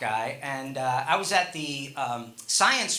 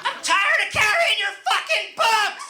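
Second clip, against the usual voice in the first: very high mock screech; 4 dB louder than usual. Old man to his wife about carrying books, high-voiced shriek. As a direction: shriek it like an exasperated old man, far up in pitch. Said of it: "Just high voice like that".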